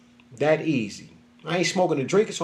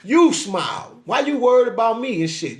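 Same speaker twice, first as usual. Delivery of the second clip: much higher and louder, snapping back; angry retort; 7 semitones higher, 4 dB louder than usual. Retold or imitated. Telling a stranger who says smile off, angry and loud. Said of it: "You be like".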